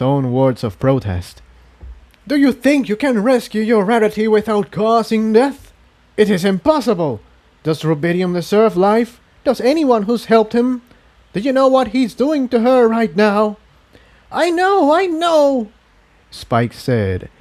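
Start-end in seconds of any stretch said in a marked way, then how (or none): 2.3-13.6 higher, urgent pressing voice; insistent alien voice. Acted. The voice pushes Spike to kill, insistent. 14.3-15.7 higher, strained anger; angry young dragon. Acted. Spike admits the truth, shaking with anger. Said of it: "shaking with anger".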